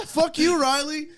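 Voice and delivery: very high put-on heckler voice; imitated hockey player. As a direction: trade insults in a high, mimicking voice. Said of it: "And the other guy, and he goes".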